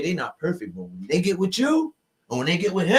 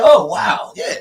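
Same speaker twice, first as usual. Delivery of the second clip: mock amazement; 7 semitones higher, 9 dB louder, slower than usual. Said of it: "They're like".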